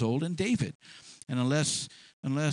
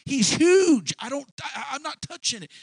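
high, nervous backing-out voice; a soldier ducking the fight, higher and jittery; scared soldier; 9 semitones higher, 4 dB louder than usual. Retold or imitated. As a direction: squeak out excuses like a frightened soldier. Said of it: "They were all afraid".

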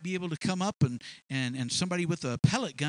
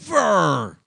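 loud, falling exclamation; emphatic shout; 11 dB louder than usual. Real, not acted. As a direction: thunder out the denial. Emphatic answer to own question.

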